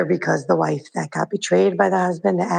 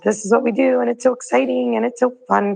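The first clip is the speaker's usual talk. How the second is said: higher, bubbly imitation of eager brides; excited; 6 semitones higher than usual. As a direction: gush it like everyone's thrilled. Voicing community's excited attitude.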